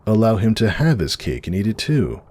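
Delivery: normal talking voice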